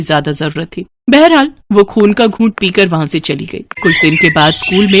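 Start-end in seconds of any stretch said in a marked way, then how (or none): none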